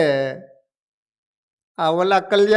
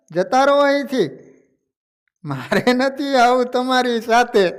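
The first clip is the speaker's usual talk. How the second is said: put-on old man snapping back, higher and louder; angry old man; 4 semitones higher, 4 dB louder than usual. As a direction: scold the monks, shoo them away. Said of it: "ડોસો ખીજાયો અને કહેવા લાગ્યો".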